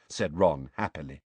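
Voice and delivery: ordinary delivery, nothing marked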